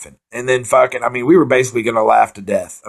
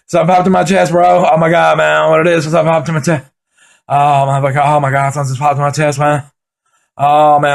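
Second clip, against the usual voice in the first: loud, high panicky rant; freaking out that something popped; mock panic, shouting; panicked freak-out; 4 semitones higher, 4 dB louder than usual. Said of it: "I just start freaking out".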